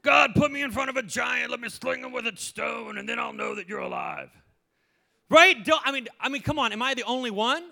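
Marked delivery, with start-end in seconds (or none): 0.0-4.3 demanding prayer. Retold or imitated. Believer demanding miracles from God, exaggerated pleading. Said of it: "And we're like".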